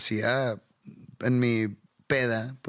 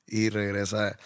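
ordinary delivery

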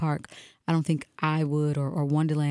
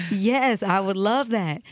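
higher, louder retold delighted yes; eager acceptance; 5 semitones higher, 4 dB louder, slower than usual. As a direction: act out gushing agreement, stretching the words. Acted eager acceptance of invitation.

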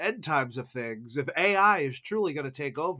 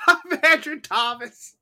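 laughing; 12 semitones higher, 8 dB louder than usual. Real, not acted. Laughing while introducing self.

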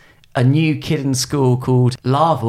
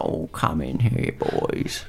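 put-on whispery, soft old man voice; breathy old man, much softer; 2 semitones lower, 7 dB quieter, slower than usual. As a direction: play an elderly dad, almost voiceless. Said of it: "he's got an old man as a dad".

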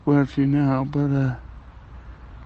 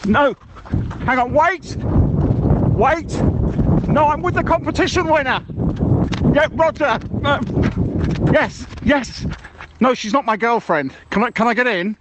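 high, urgent calls to someone away from the phone; calling out; 11 semitones higher than usual. Acted. Shouting to the crew not to leave him behind, high and urgent. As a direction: shout after them to wait, pitch up.

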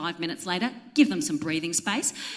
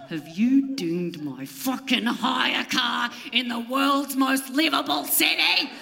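slow, drawn-out furious demand; outraged accusation; 3 semitones higher, slower than usual. Retold or imitated. To the valet who brought her hire car, slow mock-furious accusation. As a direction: accuse him word by word.

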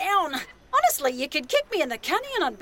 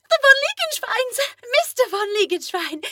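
high, loud effusive welcome; gushing hostess; 10 semitones higher, 6 dB louder than usual. Acted. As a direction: gush over the guests. Hostess greets guests effusively.

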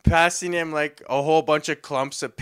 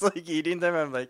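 ordinary talk, nothing marked

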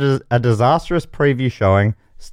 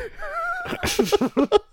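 high, drawn-out squealing laugh; helpless laughter; 8 dB quieter than usual. Real, not acted. Host loses it at own cow joke, helpless high laughter. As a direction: crack up until it turns to a high wheeze. Cracks up reading the cow synopsis.

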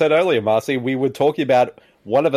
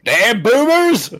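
mock grumpy curse; 9 semitones higher, 3 dB louder, much slower than usual.

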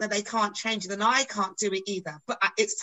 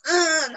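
mock crying groan; 7 semitones higher, 7 dB louder than usual. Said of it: "go home crying".

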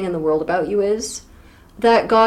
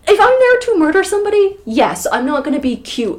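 insistent; 10 semitones higher, 3 dB louder than usual. Real, not acted. Insistent mock argument.

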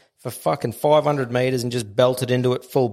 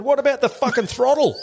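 much higher, indignant challenge on What; 13 semitones higher than usual. Retold or imitated. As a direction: throw the question at the crew in outrage. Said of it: "I said".

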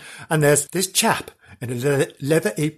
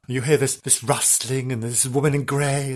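normal talking voice

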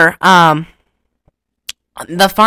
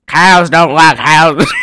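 put-on slow, loud punch-drunk farmer voice; dazed farmer; 2 semitones lower, 6 dB louder, slower than usual. Farmer tells his wife about cows, dazed slow voice. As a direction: sound like the mule kicked you. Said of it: "He sounded like the mule kicked him".

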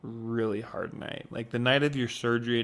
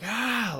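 imitated irritated scream; 12 semitones higher than usual. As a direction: mimic a frustrated patient's short scream. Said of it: "You would scream and just yell".

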